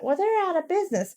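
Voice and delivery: high whiny customer voice; mocking customer voice. Imitating a clueless customer to a friend, high whiny voice.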